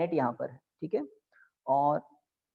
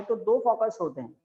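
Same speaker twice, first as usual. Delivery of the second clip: normal talking voice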